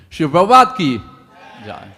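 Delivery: loud ringing call to the crowd; devotional call. Real, not acted. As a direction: shout it out for all to answer. Preacher calls out devotional chant.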